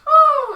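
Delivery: loud high owlbear hoot; sudden piercing creature whoop. Acted. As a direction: hoot at full blast like a beast. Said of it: "and the owlbear goes".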